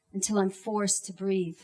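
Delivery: ordinary talk, nothing marked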